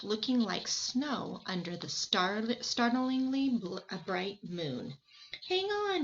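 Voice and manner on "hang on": high, loud call to the group; shouted. Acted. Boy calling to his friends, shouting. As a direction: shout it over the wind. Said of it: "he shouted".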